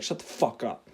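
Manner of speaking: retold low, quiet, fed-up dismissal; pissed off. Roommate driving home after a bad purchase, quietly pissed off. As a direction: imitate an annoyed friend, low and under the voice. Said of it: "and he's pissed".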